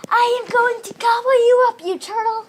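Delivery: very high, loud monster yell; crocodile yelling. Acted. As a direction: bellow the threat at the turtle. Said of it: "turned around and yelled".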